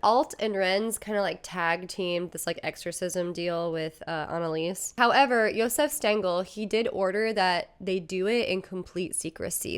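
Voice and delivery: ordinary delivery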